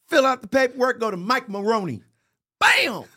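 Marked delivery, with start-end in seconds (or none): 2.6-3.0 high, loud exclamation; triumphant. Real, not acted. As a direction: punch it like a win. Triumphant deal moment.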